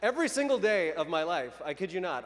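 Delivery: normal talking voice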